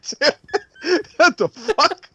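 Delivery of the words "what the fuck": high, slow, laughing through the words; laughing mock outrage; laughing quote. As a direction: giggle out the line. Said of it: "that's what he said".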